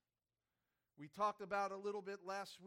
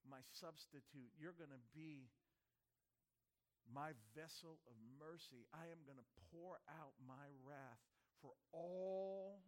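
soft, grave voice of the Father; solemn Father; 5 semitones lower, 9 dB quieter than usual. Retold or imitated. Father telling the Son his mission, soft and grave. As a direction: speak it like a heavy charge to a son. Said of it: "the Holy Father looked at his Son and said".

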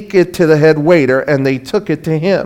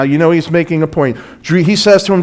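normal talking voice

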